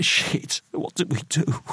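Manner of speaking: high half-whisper, close to sobbing; tearful, breathy desperation; whispered, sobbing despair. A narrator's reading. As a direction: whisper it in helpless panic. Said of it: "whispered Roy".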